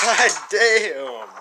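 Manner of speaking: loud, high, stunned exclamation; shocked. Real, not acted. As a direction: blurt it out in disbelief. Shocked by rage clip.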